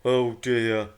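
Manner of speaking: lightly voiced rumbling giant; a hint of a rumbling island's voice. A narrator's reading. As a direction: let it rumble out in dismay. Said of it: "he rumbled".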